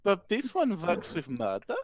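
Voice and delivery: gushing fake fascination, laughing; hammed-up fake interest to distract the smith; feigned interest. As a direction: pretend to be enthralled by the knives. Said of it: "feigning as much interest as I can".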